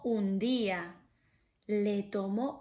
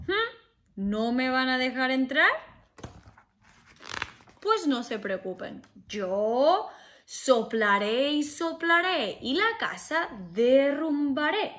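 loud booming wolf threat; threatening wolf; 4 dB louder than usual. Acted. Wolf threatening to blow the pig's house down, loud. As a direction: huff it out to scare them. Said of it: "Y el lobo dijo".

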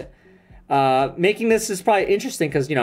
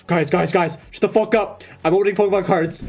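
lightly acted streamer calling to viewers; mock livestreamer. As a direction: play at hushing people for a scuffed stream. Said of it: "Just be like".

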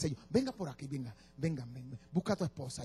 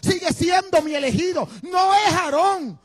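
high, full-force preacher shouting as God; impassioned divine shout; 12 semitones higher, 12 dB louder than usual. Acted. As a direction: thunder God's choice at Moses, building to a peak. Dios exhorta a Moisés, predicado. (grito apasionado de Dios a Moisés)

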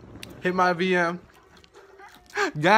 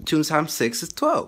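normal talking voice

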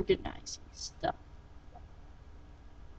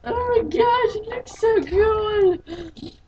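very high, loud, drawn-out gushing; gushing squeal; 12 semitones higher, 5 dB louder, slower than usual. Real, not acted. Player seeing the new cap on, high delighted squeal. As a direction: squeal with delight, stretching the words.

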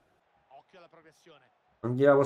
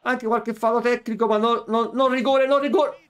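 angry protest; 10 semitones higher, 5 dB louder than usual. Real, not acted. Gamer protesting penalty call.

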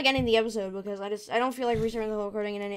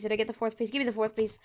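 usual speaking voice

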